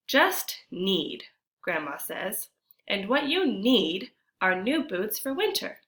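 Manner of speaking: put-on expressive read-aloud for children; storybook narration. A narrator's reading. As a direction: tell the story to the class with feeling. Reads picture book aloud.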